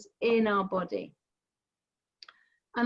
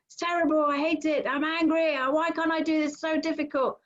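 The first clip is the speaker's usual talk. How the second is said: high, whiny, frustrated self-scolding; mock the mind beating itself up, pitched up; self-critical frustration; 6 semitones higher than usual. Teacher voicing a harsh inner critic, frustrated and pitched high. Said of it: "it's not for you to then go".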